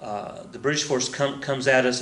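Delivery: ordinary delivery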